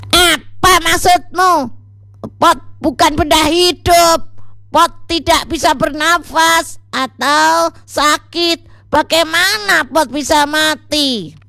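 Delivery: very high-pitched indignant protest; firm, angry. A narrator's reading. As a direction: challenge the absurd claim in a high, angry voice. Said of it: "dengan tegas". (tegas dan marah, suara tinggi)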